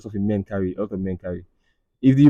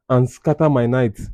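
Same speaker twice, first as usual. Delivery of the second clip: ordinary talk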